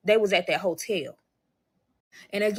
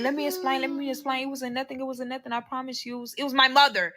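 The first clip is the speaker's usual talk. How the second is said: higher-pitched mock pleading; running excuses, pitched up; frantic explaining; 5 semitones higher than usual. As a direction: imitate him scrambling to explain himself. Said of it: "he was trying to explain".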